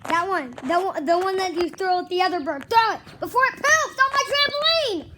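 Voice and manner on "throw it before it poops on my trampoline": higher, louder urgent shout; urgent yelling. Real, not acted. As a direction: hurry him with a yell. Mom yelling urgently at son.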